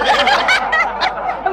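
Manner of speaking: high-pitched giggle; high laugh. Acted. Clerk pleased with her sewing explanation, giggling. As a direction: giggle high after a silly joke.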